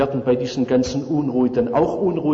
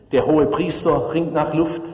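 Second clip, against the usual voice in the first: normal talking voice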